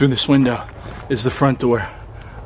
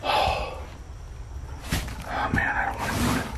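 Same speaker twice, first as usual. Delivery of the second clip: scared whisper; 10 dB quieter than usual. Real, not acted. Scared, refusing to go on.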